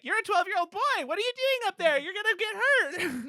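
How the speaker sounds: high-pitched mock fretful scolding; hammed-up alarmed big sister. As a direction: tell off a kid, shrilly exasperated. Voicing woman scolding little brother.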